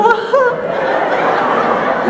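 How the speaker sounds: put-on high, breathy elderly laugh; an old woman giggling delightedly; high unvoiced cackle; old lady cackle.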